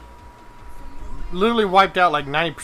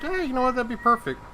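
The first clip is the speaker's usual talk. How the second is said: pleased self-quote; 9 semitones higher than usual. Retold or imitated. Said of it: "so I said".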